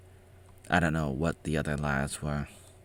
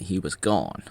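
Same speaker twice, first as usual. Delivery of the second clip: usual speaking voice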